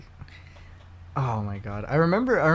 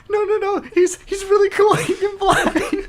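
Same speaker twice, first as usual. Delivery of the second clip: much higher, giggling nerd voice insisting; squeaky nerd fan; 7 dB louder than usual. Pretend. Friend plays the excited fan defending the flying hero, laughing squeal. Playing the nerdy fan in theater.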